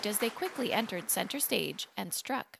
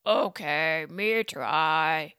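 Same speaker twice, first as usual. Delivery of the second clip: lower, louder monster voice; lumbering monster voice; 4 semitones lower, 4 dB louder, much slower than usual. Acted. Frankenstein agreeing to dance with Boney, monster grunt. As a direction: say it like a slow, dim monster.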